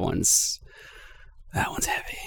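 hushed, breathy whisper. Real, not acted. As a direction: drop to a whisper. Hushed remark about heavy album.

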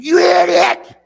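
high, loud yell at the other driver; angry yell. Retold or imitated. Preacher retelling yelling at a driver, furious shout. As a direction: scream it at the car that cut you off. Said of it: "I said".

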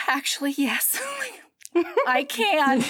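high giddy reply through laughter; giddy heroine. Acted. Heroine telling hero she is ready, high and giddy. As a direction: chirp it out, laughing.

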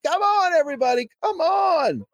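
rally the crowd to follow you; cheerleading a crowd, pitch way up; calling out. Pretend. Calling crowd to run with him.

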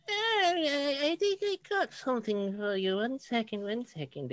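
put-on high, quavering old lady voice; very old woman. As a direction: play a frail shopkeeper granny, high-pitched. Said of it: "very old lady".

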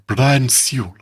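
lightly voiced art critic; a hint of an art-critic impression; Brian Sewell. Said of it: "It's a very good impression".

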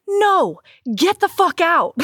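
high, indignant retold dismissal; mock outrage. Woman refusing to forgive him, comically indignant.

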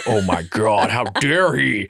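slow, hammed-up scandalized onlooker; mock outrage. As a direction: draw it out like an appalled bystander. Voices imagined disapproving shoppers behind him.

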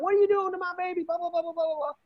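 very high, hurried mock-hysterical mother; hammed-up frantic mom; imitated freaking out. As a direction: wail it in falsetto, tumbling into babble. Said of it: "Everyone's freaking out".

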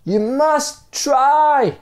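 high-pitched acted cry; desperate appeal. A narrator's reading. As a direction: plead slowly and loudly from below. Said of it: "appealed the stranger".

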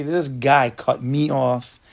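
slow, drawn-out indignant complaint; mock angry driver. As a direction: play the fuming driver, dragging each word.

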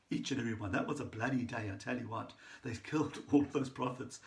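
ordinary delivery

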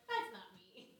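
high, loud retold protest; amused disbelief. Laughing off being told she'd preach, high and loud.

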